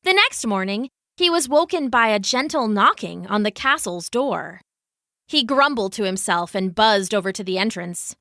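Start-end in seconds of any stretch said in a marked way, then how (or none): none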